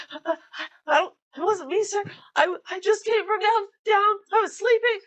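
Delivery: high, stammering panicked sailor; plead, tripping over the words; panicking sailor. Acted. Grabbed sailor pleading with the captain, high and panicky. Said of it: "like, panicking".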